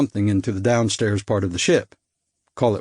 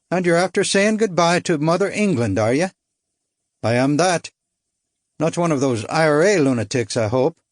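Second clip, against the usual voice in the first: put-on higher, lilting light Irish accent; 5 semitones higher than usual. Irishman sizing up a fellow exile, light Irish lilt. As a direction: tease him like a chatty Irishman. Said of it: "I couldn't place his accent".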